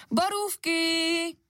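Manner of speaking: high, slow sing-out call; calling from afar. A narrator's reading. A call from the dining room for snack, high and drawn-out. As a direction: draw the word out high, calling someone to the table. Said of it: "ozvalo vytoužené".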